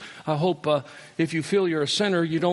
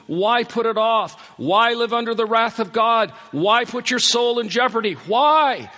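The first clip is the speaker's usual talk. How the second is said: rising, pleading cries, louder and higher; impassioned preaching; 8 semitones higher, 7 dB louder, slower than usual. Real, not acted. Preacher crying out to the unconverted, impassioned. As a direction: build to a fervent plea.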